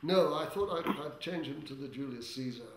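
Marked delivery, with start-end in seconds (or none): none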